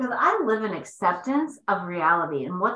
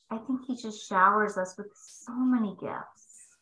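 whispered. Real, not acted. Telling listeners about God's gifts, whispered. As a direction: breathe it out like a secret blessing. Sharing her faith.

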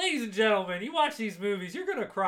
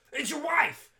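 urgent call; 10 semitones higher, 6 dB louder than usual. Retold or imitated. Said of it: "He's like".

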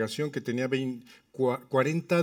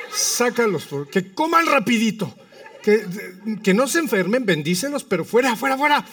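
loud, high comic pleading; hammed-up desperate petition; retold urgent prayer; 6 semitones higher, 5 dB louder than usual. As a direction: pray insistently, building to a command. Retold desperate prayer to clear tables.

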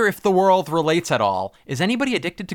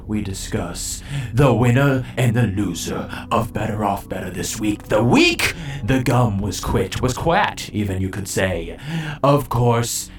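announcer voice; 4 semitones lower, slower than usual. Acted. Hosts the show's award segment.

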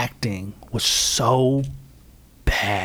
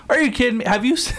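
high incredulous; 9 semitones higher, faster than usual. Retold or imitated. Incredulous reaction while watching movie.

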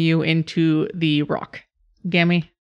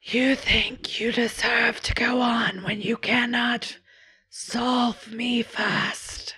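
slow, eerie whisper of a shadow creature; whispering beast voice; 6 semitones higher, 5 dB quieter, much slower than usual. Acted. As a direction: hiss the riddle slowly in a whisper.

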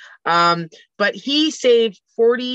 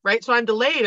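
ordinary delivery

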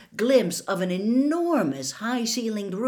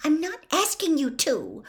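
high-pitched impatient retort; 9 semitones higher than usual. A narrator's reading. Hermione answering Ron's refusal, high and impatient. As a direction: cut back impatiently with the voice raised high. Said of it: "Hermione said impatiently".

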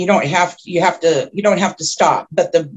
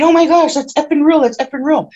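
panicked shrieking; 8 semitones higher than usual. Retold or imitated. Friend in the car sees the lizard creature, panicked shriek. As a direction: replay a friend's terrified shriek. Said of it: "she starts screaming and punching me".

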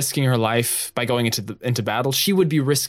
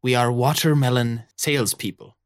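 lightly voiced rum-soaked pirate captain. Captain warning two squabbling pirates, lightly rum-soaked. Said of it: "with rum-heavy breath".

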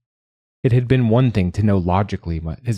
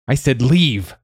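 higher, forceful order; angry command; 5 semitones higher, 3 dB louder, slower than usual. Acted. Mother ordering her son to leave, angry. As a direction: drive the child away.

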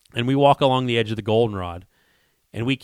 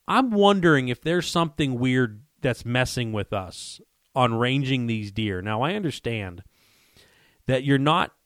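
ordinary talk

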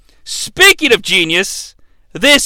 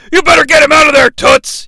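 fast, louder mock yell; 7 semitones higher, 5 dB louder, faster than usual. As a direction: bark it like a heel commentator. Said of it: "yells the Brain".

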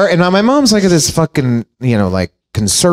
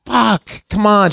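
exasperated; 5 semitones higher, slower than usual. Retold or imitated. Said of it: "I'm like".

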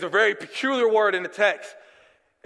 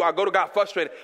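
normal talking voice

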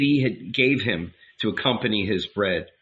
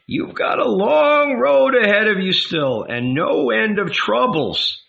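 put-on high, urging old woman, pressing him to eat; old lady, pressing him; 10 semitones higher, 4 dB louder than usual. A narrator's reading. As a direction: coax him like a grandmother. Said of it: "she said with a laugh".

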